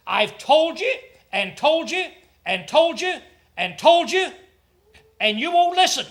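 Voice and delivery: high and loud, building each repeat; rising exasperated scolding; exasperated God. Acted.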